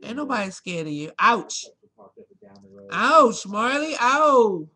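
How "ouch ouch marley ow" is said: loud, higher ouch cries; loud pained ow at the pet; real pained yelps. Real, not acted. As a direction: yelp and scold the biter. Pet bites her again.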